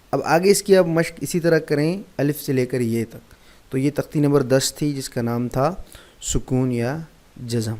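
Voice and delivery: ordinary talk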